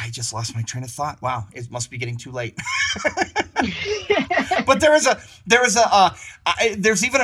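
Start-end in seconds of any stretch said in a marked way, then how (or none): 2.6-4.6 a high burst of embarrassed laughter. Real, not acted. Guest losing his train of thought, laughing at himself. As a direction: laugh it off at yourself, high and bright.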